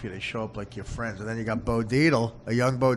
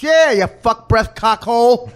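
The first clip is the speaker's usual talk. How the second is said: loud, higher mock-cop yell; cop talk; 12 semitones higher, 15 dB louder, slower than usual. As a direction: spit insults like a movie cop. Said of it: "They talk like cops".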